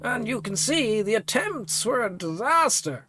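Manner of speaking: a weary priest lecturing; old priest NPC. Acted.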